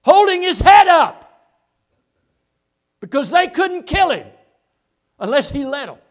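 loud, high, ringing sermon shout; full-force preaching. Real, not acted. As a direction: proclaim it valiantly to the congregation.

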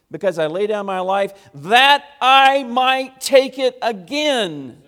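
loud, high-pitched preacher's proclamation; full-force preaching. Acted. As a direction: proclaim the scripture loudly, leaning hard on key words.

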